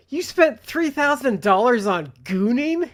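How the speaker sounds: high, loud, drawn-out incredulous question; appalled disbelief. Retold or imitated. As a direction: imitate a host aghast at the purchase. Acting the appalled host.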